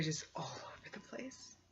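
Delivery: hushed creeped-out whisper; spooked whisper. Real, not acted. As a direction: drop to a whisper as if the bugs might hear. Creepy bugs described.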